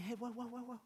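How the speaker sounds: a hint of a calm teacher reining his people in; acting out Jesus reminding believers what he showed them; gentle rebuke. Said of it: "Jesus saying".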